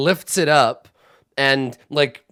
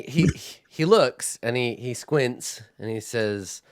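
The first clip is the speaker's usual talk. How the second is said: ordinary talk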